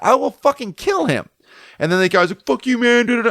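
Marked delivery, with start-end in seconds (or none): 0.0-1.3 slow, high-pitched retold threat; heated threat, drawn out; acted angry threat. 2.5-3.3 high imitation of the cursing friend; retold comeback, pitched way up; mock angry retort.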